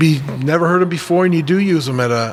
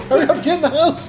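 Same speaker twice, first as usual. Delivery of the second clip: mock panic; 12 semitones higher than usual. Fleeing the giggler in the woods.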